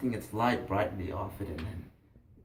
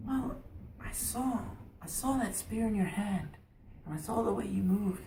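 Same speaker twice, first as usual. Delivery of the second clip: put-on higher, softly earnest young lad; awed boy; 6 semitones higher, 3 dB quieter than usual. Boy telling fisherman what drew him, awed. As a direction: tell it with hushed wonder. Boy explains his fascination.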